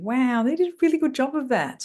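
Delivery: pleasantly wowed; praising. Acted. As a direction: give credit with lifted, admiring pitch. Acting out praise as a deposit.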